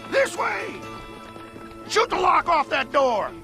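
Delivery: rally the men forward with a yell; shouted command. Acted. Leader moves his men in under cover, shouting.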